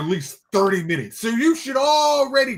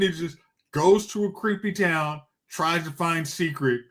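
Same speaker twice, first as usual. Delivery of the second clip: ordinary talk, nothing marked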